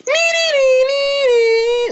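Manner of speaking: very high squealing nee-nee sound; falsetto theme squeal. Pretend. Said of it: "that was my attempt at Dick Dominic's theme".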